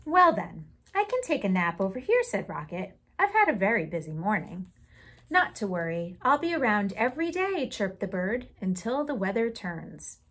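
put-on lively read-aloud for children; storytime reading. Teacher reading a picture book aloud to the class, lively storytime voice.

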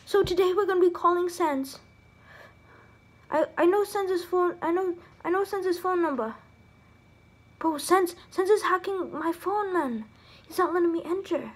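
hushed, nervous prank voice; scared hush. Pretend. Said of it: "I'm so scared".

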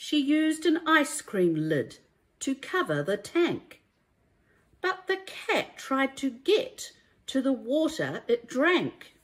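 lively, sing-song rhyming storybook read; reading to children. A narrator's reading. Teacher reading a rhyming picture book to children, lively and sing-song. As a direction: bring each rhyme to life for little listeners.

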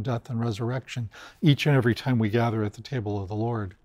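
normal talking voice

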